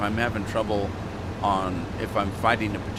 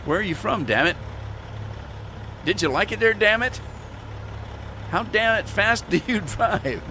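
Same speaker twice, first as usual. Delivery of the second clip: grumpy damn it version; 10 semitones higher than usual. Retold or imitated. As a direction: grumble every question with damn it like a crank. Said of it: "so I would insert, like, "Damn it," in there".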